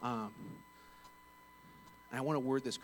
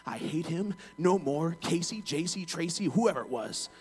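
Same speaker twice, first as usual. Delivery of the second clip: loud, raised mock tirade; angry rant; 5 semitones higher, 6 dB louder, slower than usual. Furious caller complaining to the manager by voicemail, hammed-up rant. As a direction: imitate a customer venting on a voicemail, building in force.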